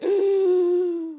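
sharp high gasp of awe; starstruck gasp. Retold or imitated. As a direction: gasp like you just saw a celebrity.

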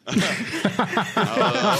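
bursting into a laugh. Real, not acted. Laughing while telling the host how flustered he got. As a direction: laugh out loud at himself.

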